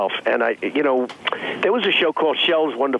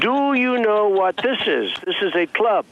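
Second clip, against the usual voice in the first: mock patient explaining to a beginner, higher pitch; slow teacher voice; 7 semitones higher than usual. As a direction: spell it out slowly, like to a child. Retelling lesson for Miss Universe.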